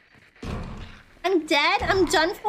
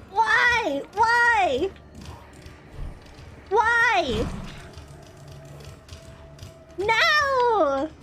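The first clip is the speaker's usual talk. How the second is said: despairing cries; 5 semitones higher, much slower than usual. Real, not acted. Boss chase, despairing cries.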